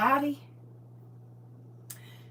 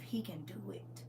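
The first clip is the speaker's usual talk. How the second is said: whispered faith; 4 semitones lower, 17 dB quieter than usual. Real, not acted.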